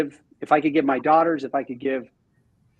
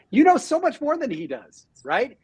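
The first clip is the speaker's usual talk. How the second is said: much higher, urgent insistence; emphatic encouragement; 9 semitones higher than usual. Retold or imitated. Owner urging new female agent she knows more. Retold pep talk to agent.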